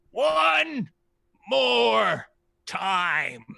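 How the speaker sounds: high, loud, drawn-out dragon shout; a greedy dragon crowing for treasure. Acted. Dragon demanding treasure from the party, high loud greedy shout.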